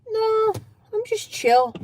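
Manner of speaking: lightly acted noncommittal answer, starting high; unbothered reply. Guest brushing off a relative's prying, mildly acted unbothered. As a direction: brush the question off with a high opening.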